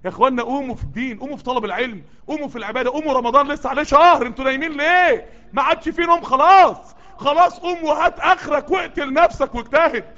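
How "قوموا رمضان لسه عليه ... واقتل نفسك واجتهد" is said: loud, high, exasperated preaching; sweating, worked-up preacher; heated urging. Real, not acted. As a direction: scold the sleepers awake. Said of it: "شايفيني بعرق ومتنرفز".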